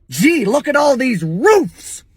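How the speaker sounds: slow, drawn-out "roofs" said like a puppy's "woofs"; a puppy yipping "roofs". Shown as a demonstration. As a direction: stretch "roofs" until it barks like a baby dog. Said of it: "say roofs three times and tell me you don't sound like a baby dog".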